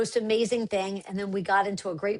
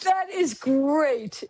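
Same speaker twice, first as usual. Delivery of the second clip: laughing; 4 semitones higher, much slower than usual. Real, not acted. Laughing at the story.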